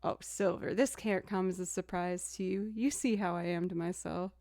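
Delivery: usual speaking voice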